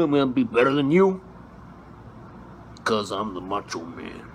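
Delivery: Macho Man Randy Savage. Acted. Comedian doing Macho Man taunting Hulk Hogan, wrestling-promo growl. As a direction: cut a gravel-voiced wrestling promo, oh yeah. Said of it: "Mister Macho Man Randy Savage".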